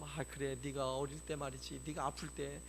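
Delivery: a hint of a fond mother; mother's diary voice. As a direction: read it like a loving mother's diary. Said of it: "엄마들의 육아일기처럼".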